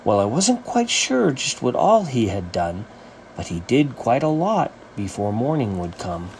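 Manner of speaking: ordinary delivery, nothing marked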